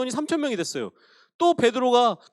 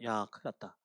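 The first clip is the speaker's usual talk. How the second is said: hushed dismay; 13 semitones lower, 12 dB quieter than usual. Acted. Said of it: "이런 마음들이 생겼을 거예요".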